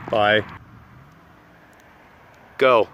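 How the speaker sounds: ordinary talk, nothing marked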